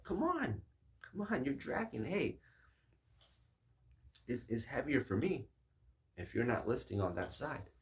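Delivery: high-pitched, impatient 'come on, you're dragging'; frustrated urging. Retold or imitated. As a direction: push him to lift, exasperated. Frustrated lifter.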